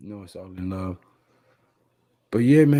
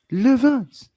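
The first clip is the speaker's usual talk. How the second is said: put-on French accent; 13 semitones higher, 6 dB louder than usual. Mock French after Levance's name.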